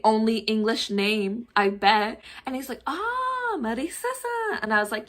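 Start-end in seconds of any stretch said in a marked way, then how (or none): 2.9-4.6 high drawn-out "ah" of realization; imitated high, delighted recognition; dawning recognition. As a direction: suddenly get it, pitched way up. Delivery man suddenly recognizes her name.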